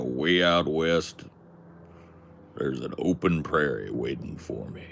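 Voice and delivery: easy cowboy twang, lightly done; country accent. Retold or imitated. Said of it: "I like a country accent".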